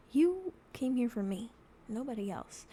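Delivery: normal talking voice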